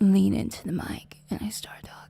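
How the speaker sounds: close, hushed mic whisper; leaning into the mic. Shown as a demonstration. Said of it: "But not this quiet".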